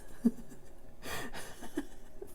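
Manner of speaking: soft helpless giggle; giggle, trying to hold it in; breathy giggle. Real, not acted. Laughing at the pearl diving name, soft helpless giggle.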